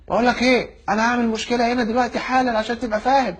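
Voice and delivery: high-pitched indignant warning; worked-up, high-pitched protest; retold angry threat. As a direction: replay a heated airport threat. Passenger threatens a complaint.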